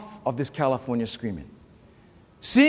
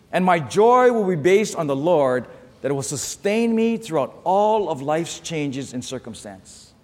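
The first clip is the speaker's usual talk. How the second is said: ordinary talk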